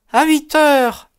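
high, strained reply; answered with effort. A narrator's reading. Mother answering the detective's question, with effort. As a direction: force the answer out in a high woman's voice. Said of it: "a-t-elle répondu avec effort".

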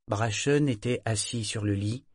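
ordinary delivery

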